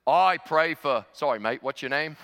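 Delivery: booming Aussie prayer opening, then casual; brash Australian. Retold or imitated. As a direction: imitate a brash Aussie praying. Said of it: "known for a very brash personality".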